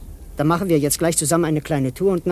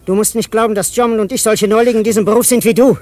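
menacing outburst at the rival, louder; threatening; 3 semitones higher, 7 dB louder than usual. Acted. Crook scolds his old partner, loud and menacing.

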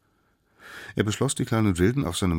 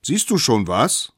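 raised, louder eager question; calling up; 7 semitones higher, 8 dB louder than usual. A narrator's reading. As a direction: call up to someone high above. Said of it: "riefen sie hinauf". (fragend nach oben gerufen)

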